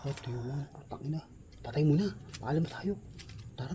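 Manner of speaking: barely above a whisper, spooked; scared hushed voice. Real, not acted. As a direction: hush it, afraid something hears you. Scared hunters whisper after hearing voice. (takot na pabulong)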